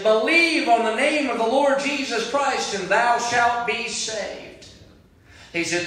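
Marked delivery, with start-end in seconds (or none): 0.0-4.7 high, loud, slow pleading call; preacher calling to a drowning man. Retold or imitated. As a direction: plead across the water, stretching the last words. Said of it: "the voice came back and said".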